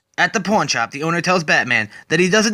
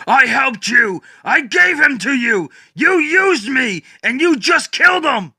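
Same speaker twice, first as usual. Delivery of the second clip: furious; 8 semitones higher, slower than usual. Acted. Batman yelling at Swamp Thing after the killing, furious. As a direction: hurl the betrayal at him.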